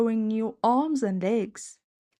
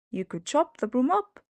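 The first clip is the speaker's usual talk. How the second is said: ordinary delivery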